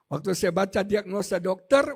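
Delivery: ordinary delivery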